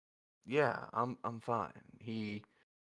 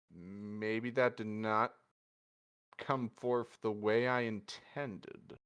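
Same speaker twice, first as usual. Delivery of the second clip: slow, measured realisation; slower than usual. Acted. Zenith realises his words came out wrong.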